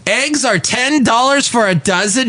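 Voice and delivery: high-pitched disbelief at the price; incredulous. Pretend. Reacting to shocking egg prices.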